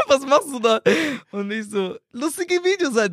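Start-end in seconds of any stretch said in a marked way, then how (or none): 0.1-0.8 much higher, incredulous mock question; imitated baffled father. Father questioning his son's videos, imitated disbelief. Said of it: "Und der so". 2.1-3.1 high, drawn-out mock protest; defensive son. Son justifying his videos to father, mock defensive.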